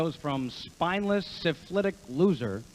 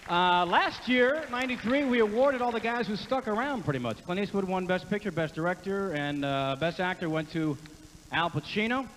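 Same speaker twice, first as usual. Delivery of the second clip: usual speaking voice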